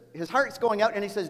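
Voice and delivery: normal talking voice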